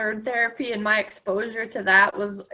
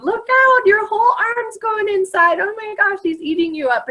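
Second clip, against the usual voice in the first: mock alarm; 12 semitones higher, 4 dB louder than usual. Teasing girl as dog licks.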